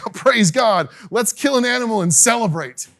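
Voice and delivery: loud, high burst of praise; jubilant father. Acted. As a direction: act out a parent's overjoyed relief. Voicing father rejoicing over returned son.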